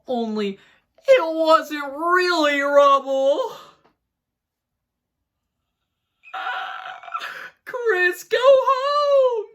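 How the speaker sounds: high, loud wailing ghost; eerie high ghost moan; ghostly voice. Acted. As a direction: wail it like a ghost calling out. Spooky reading of ghost message.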